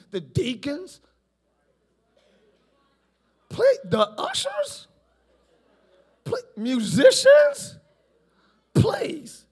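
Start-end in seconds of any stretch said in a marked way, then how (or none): 3.5-9.5 rising scornful please, drawn out on musicians; mock critic brushing off the ushers and musicians; dismissive please. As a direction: wave them all off with a high please. Dismissing everyone in turn.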